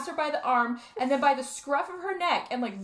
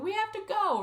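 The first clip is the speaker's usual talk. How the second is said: much higher urgent cry; urgent daughter; 10 semitones higher than usual. Retold or imitated. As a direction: voice the daughter dragging her dad away. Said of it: "she's like".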